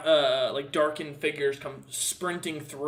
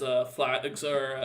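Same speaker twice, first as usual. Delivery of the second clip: normal talking voice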